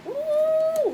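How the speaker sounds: usual speaking voice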